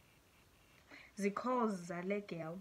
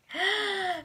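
high, audible intake of breath; sharp gasp; 10 semitones higher, 5 dB louder than usual. Real, not acted. Vlogger moving on to another confession, gasping. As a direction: gasp suddenly before carrying on.

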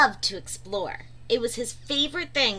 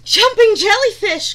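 much higher, louder yelp; crying out; 10 semitones higher, 11 dB louder than usual. A narrator's reading. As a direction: shout it in sudden alarm. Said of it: "cried Stanley".

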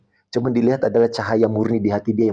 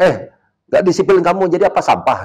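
higher, louder angry snap; angry scolding; 7 semitones higher, 4 dB louder than usual. Retold or imitated. Coach berating undisciplined student, louder angry scolding. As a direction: bark at him in contempt.

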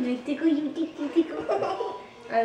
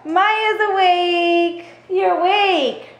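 higher, louder, slow stretched delight; 7 semitones higher, 5 dB louder, much slower than usual. Real, not acted. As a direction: stretch out the words with joy. Greeting baby who just woke.

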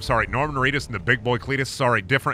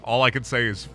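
ordinary talk, nothing marked

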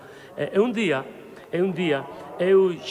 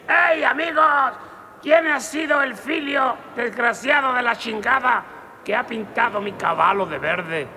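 macho shout; 6 semitones higher than usual. Acted. Tiny macho Mexican yelling at the bar, high and angry. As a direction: yell at the whole bar like a little tough guy.

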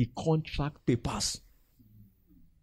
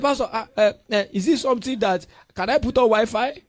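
high, loud hesitant plea; loud hesitant member asking for Wi-Fi; pleading member; 6 semitones higher, 6 dB louder than usual. Retold or imitated. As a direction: stammer out the request nervously.

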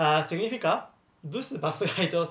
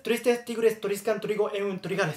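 rapid, higher-pitched tongue-twister recitation; 4 semitones higher than usual. Shown as a demonstration. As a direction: rattle it off without pausing. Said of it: "hablar rápido".

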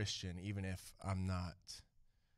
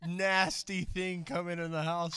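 higher, louder disgusted impression of wife; mock disgusted wife; 8 semitones higher, 8 dB louder than usual. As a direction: ham up a grossed-out wife, laughing. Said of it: "I don't usually do that good of an impression".

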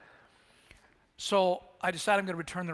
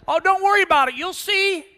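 loud, much higher breezy shout; casual yell; 12 semitones higher, 12 dB louder than usual. Retold or imitated. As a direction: brush it off from a distance. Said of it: "he yells back".

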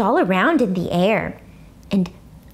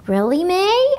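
mock surprise; 8 semitones higher than usual. A narrator's reading.

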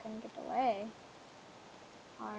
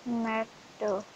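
ordinary talk

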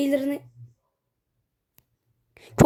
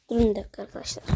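hushed whisper to the viewers; 4 semitones lower, much faster than usual. Real, not acted. Asking viewers to wait, whispered. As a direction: drop to a secret whisper. Hushing viewers mid-game.

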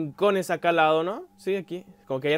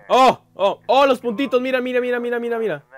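high, urgent rush of words; high-pitched startled babble; alarmed excitement; 7 semitones higher, 4 dB louder than usual. Real, not acted. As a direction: spot danger and point it out frantically. Spots moving dots in game.

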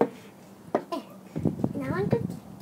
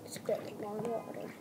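whisper; 4 semitones lower, 8 dB quieter than usual. Pretend. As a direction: murmur it like a private aside. Whispered bath play.

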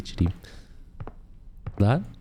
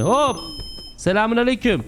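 calling out greeting; 9 semitones higher than usual. Pretend. Attendant hailing a newly arrived car, calling out. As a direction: call over to the customer in a raised pitch.

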